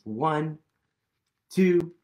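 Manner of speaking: usual speaking voice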